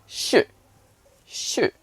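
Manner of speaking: fourth tone "shu", straight down; falling Mandarin tone. Shown as a demonstration. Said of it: "directly falling tone".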